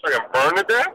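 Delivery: muffled, watery phone line; underwater gurgling voice. Real, not acted. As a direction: sounds like talking underwater. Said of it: "it sounds like you're underwater".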